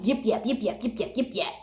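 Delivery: quiet mimicked chatter, sing-song and dismissive; mock yapping. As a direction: imitate someone droning on and on, under your breath. Mimicking manager going on and on.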